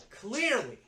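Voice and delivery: high, loud, sarcastic agreement; exasperated retort. Retold or imitated. Assistant answering coworker about the broken system, exasperated. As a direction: throw it back high and loud.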